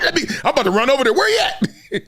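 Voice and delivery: fast, high excited burst; can't wait to get over there; hurried, excited rush to find the recruit; eager rush. Retold or imitated. Rushing to see recruit.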